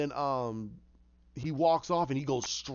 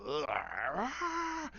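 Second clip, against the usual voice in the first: imitated pain yell; 6 dB quieter than usual. Penguin gets punched by Batman, mock pained groan. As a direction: groan rising into a strangled yell. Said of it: "He's like".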